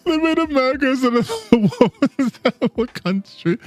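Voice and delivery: high, breaking up with laughter mid-sentence; real laughing fit. Real, not acted. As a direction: helplessly laugh your way through the words. Cracks up repeating the line.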